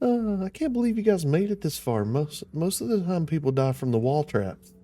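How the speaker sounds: put-on high, taunting child voice; creepy ghost kid. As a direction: sneer it like a demon child. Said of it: "it's not Girk's voice".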